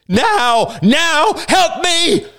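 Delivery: very high, urgent screamed plea; desperate cry. A narrator's reading. Bearded man crying for help smashing crates, frantic scream. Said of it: "cried the bearded man".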